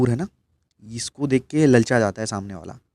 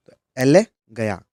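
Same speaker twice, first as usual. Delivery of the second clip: एले punched and louder; stress on एले; 5 dB louder than usual. Shown as a demonstration. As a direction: hit एले hard then गया. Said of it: "गया से याद रख रहे हैं ना". (एले पर ज़ोर देकर बोलो)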